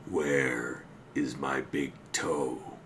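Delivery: slow, low, drawn-out moan; groaning ghost. Acted. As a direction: moan it like a corpse searching. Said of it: "it groaned".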